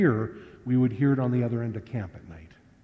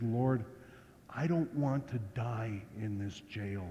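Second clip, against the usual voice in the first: soft, low pleading prayer; much softer, lower desperate plea to the Lord; 4 semitones lower, 14 dB quieter, slower than usual. Retold or imitated. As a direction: plead with God almost under the breath.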